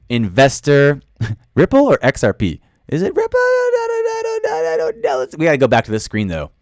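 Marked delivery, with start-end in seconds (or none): 2.9-5.2 confused squeak. Real, not acted. Confused by the hotkey.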